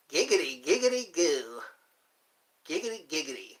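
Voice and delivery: cartoon catchphrase impression; Quagmire. Recalling Family Guy characters, catchphrase impression. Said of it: "And then there's Quagmire".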